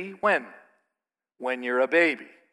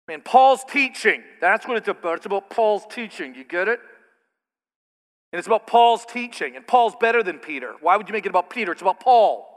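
mocking partisan; 2 semitones higher, 4 dB louder than usual. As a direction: parody a churchgoer boasting about his faction. Mocking divisive Paul-followers.

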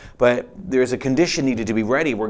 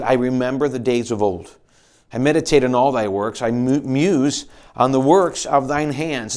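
louder, solemn psalm reading; scripture reading; 4 dB louder than usual. Acted. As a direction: read it as a wistful prayer. Reads psalm aloud.

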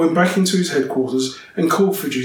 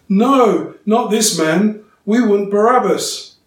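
loud, higher crowd shout; 8 semitones higher, 8 dB louder, slower than usual. A narrator's reading. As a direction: shout it back as the angry mob. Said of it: "they shouted back".

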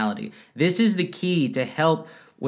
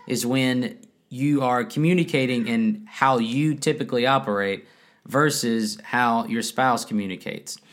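ordinary talk

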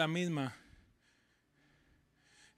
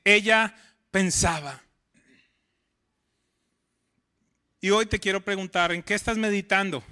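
louder, rising preacher's questions; preaching; 3 semitones higher, 7 dB louder than usual. Acted. Pastor challenges listeners about their fears, louder and building. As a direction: press each question on the congregation.

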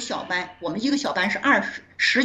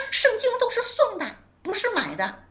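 classmate's voice; 12 semitones higher than usual. Retold or imitated. Classmate voiced in retold chat.

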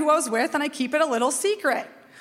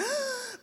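sharp high gasp of alarm; sudden startled gasp; panicked gasp; 12 semitones higher, 9 dB quieter than usual. Retold or imitated. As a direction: gasp like you just crashed. Jet ski hits pontoon.

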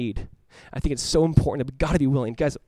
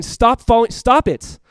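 louder, higher acted command; forceful opposing voice; 6 semitones higher, 6 dB louder, slower than usual. The voice in your life deterring you from Jesus, forceful. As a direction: act out a voice ordering someone to quit.

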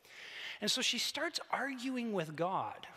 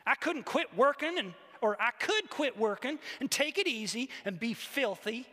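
loud, high indignant tirade; acted rant, punching the words; growled grievance; 5 semitones higher, 5 dB louder than usual. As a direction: argue with God, wronged and self-righteous. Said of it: "she growled".

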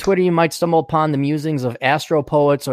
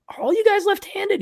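high retold question.

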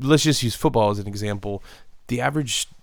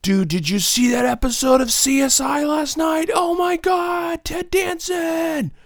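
mock fanboy squeal; 5 dB louder than usual. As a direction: squeal about a TV star like a superfan. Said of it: "you know, like".

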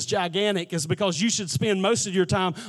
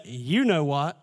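much lower, knowing parrot taunt; sly punchline; 8 semitones lower than usual. Retold or imitated. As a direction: drop low and let it rise at the end.

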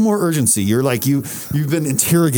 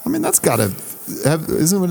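ordinary talk, nothing marked

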